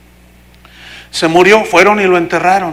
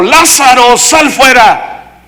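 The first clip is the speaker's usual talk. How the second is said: loud, slow, raised command; commanding call; 5 semitones higher, 5 dB louder, much slower than usual. Retold or imitated. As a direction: call him out of the tomb, loud and drawn out. Jesus calls the dead man out. (llamado fuerte y solemne)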